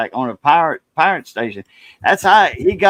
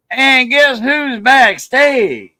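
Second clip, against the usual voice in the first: teasing announcer; 9 semitones higher, 6 dB louder, much slower than usual. Real, not acted.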